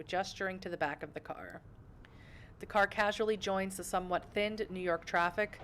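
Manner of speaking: ordinary talk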